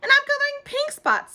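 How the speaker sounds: very high, loud preening; prissy girl. A narrator's reading. Lucille preening about her pink spots, very high and loud. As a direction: show off your pink. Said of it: "said Lucille".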